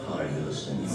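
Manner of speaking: low Mexican puppet voice; deep-voiced talking burrito. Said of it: "I'm trying to do a little ventriloquism here".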